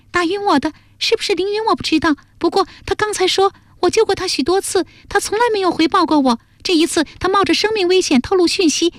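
put-on very high, small girlish voice; earnest girl character. Jialuo explains Lingyun's warning to her friends, earnest and high.

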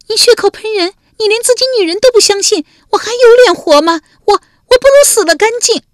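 very high, loud, fast wailing accusation; wailing, crying out. A narrator's reading. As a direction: scream it through tears. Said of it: "文姜嚎的一声哭了". (文姜嚎啕哭喊)

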